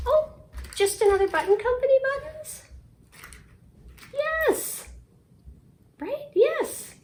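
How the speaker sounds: very high, fast delight; excited squeal. Real, not acted. Checking buttons with her friend, squealing happily.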